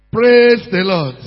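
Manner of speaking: loud, drawn-out call to the congregation; slow, forceful church call; emphatic call. Real, not acted. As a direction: stretch it and push for a response. Repeats the call more forcefully.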